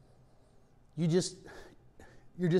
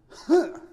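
sharp high gasp, louder; a sudden winded huff; breath knocked out; 12 semitones higher, 8 dB louder than usual. Shown as a demonstration. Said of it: "And your breath goes".

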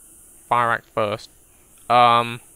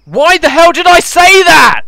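loud, high scream of alarm; panicked yell; 13 semitones higher, 15 dB louder than usual. Real, not acted. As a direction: shriek at the sudden horde. Zombies appear after jinxing.

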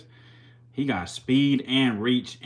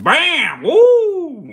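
excited shout; 10 dB louder than usual. Real, not acted. Hype shout at snake strike.

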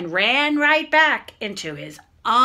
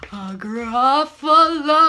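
slow, quavered mock fright; playful scared; 4 dB louder, slower than usual. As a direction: draw it out as if a little scared. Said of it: "That's me being a little scared".

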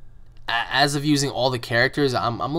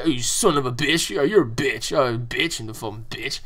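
mock insulter. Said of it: "everybody uses bitch".

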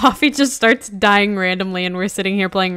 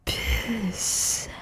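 whispered; 5 dB quieter than usual. Pretend. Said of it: "repeated word, different takes".

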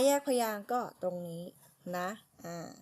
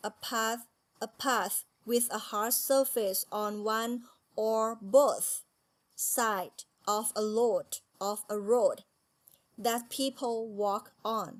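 very slow, word by word, each word separated; slow careful reading; much slower than usual. Shown as a demonstration. Teacher reading the English definition to Thai students, very slowly. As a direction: read the definition word by word for learners. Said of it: "rereading definition for class".

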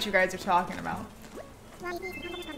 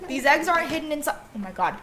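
ordinary talk

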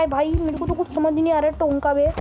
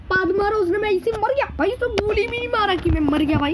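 normal talking voice

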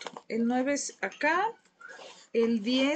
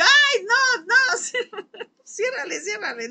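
high, loud laughing half-wail, fading; mock panic; 10 semitones higher, 7 dB louder than usual. Seeing her uncle at the door, laughing panicked plea. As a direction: beg someone to shut the door.

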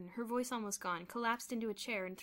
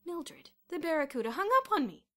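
raised, loud complaint; indignant outrage; 8 semitones higher, 10 dB louder than usual. A narrator's reading. Headmaster telling his wife of the hang-up, indignant. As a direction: complain in disbelief at the insult. Indignant after being hung up on.

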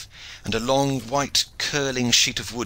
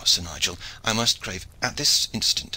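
usual speaking voice

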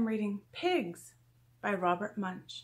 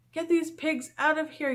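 high, urgent order, only slightly louder; high-pitched yell; 7 semitones higher, 3 dB louder than usual. A narrator's reading. Principal ordering the pigs out of the school, high mild yell. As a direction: order them out in a raised, high voice. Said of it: "yelled the principal".

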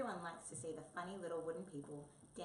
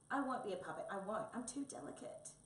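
raised, indignant, fussy protest; petulant refusal; 4 semitones higher, 4 dB louder than usual. Acted. Tabby refuses to be a puppet, petulant. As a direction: protest like a pampered cat.

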